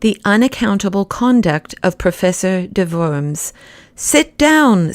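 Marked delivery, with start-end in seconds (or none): 4.0-4.9 a voice that makes men drop swords; sudden lordly order to sit; commanding voice. A narrator's reading. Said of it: "in a voice that he used once or twice in his life, a voice that made men drop drawn swords".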